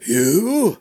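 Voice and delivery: put-on high, startled old man; taken aback. A narrator's reading. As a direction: caught off guard. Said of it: "said Crushmore, taken aback".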